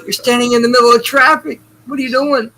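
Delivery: high, loud disbelieving shout; mock yell of disbelief at a friend; incredulous call-out. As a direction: snap at her to get out of the road. Yelling at friend in traffic.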